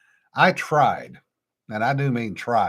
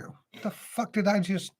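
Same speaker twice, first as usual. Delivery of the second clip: higher, softer mock bewilderment; baffled self-quote; 7 semitones higher, 6 dB quieter than usual. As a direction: act out losing your own train of thought. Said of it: "You know, and I'm like".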